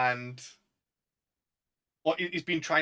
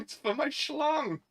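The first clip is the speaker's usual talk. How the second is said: much higher, slow mock punchline; quoting the stoner; 13 semitones higher, slower than usual. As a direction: stretch out schlong in a silly raised voice. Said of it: "And that's not a bong".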